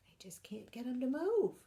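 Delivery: put-on whispered aside to young listeners. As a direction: share a secret with the children.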